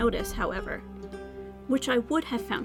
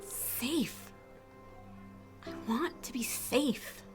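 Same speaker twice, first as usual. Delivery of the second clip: soft, fragile admission; vulnerable confession; 8 dB quieter, slower than usual. Acted. Weary woman admitting to the reaper what she wants, softly vulnerable. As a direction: say it softly, with aching longing. Confesses her longing for safety.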